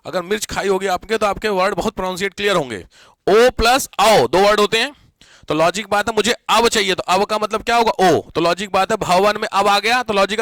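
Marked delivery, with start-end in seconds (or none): 3.3-4.3 ओ plus आओ drawn out slowly and loudly, each part apart; spelled out slowly. Shown as a demonstration. Teacher to class, spelling out the split slowly and loudly. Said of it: "अबे सीधे बोलो यार".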